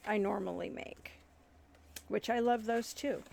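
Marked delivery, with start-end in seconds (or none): none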